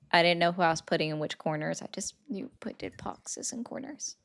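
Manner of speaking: hushed unvoiced aside; whispering. Real, not acted. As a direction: whisper it like a side note. Side note whispered to chat.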